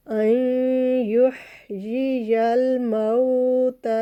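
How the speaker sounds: अन युहयियल मौता with the नून hummed through the nose into या; nasal ghunna. Shown as a demonstration. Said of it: "nasal sound बनी है".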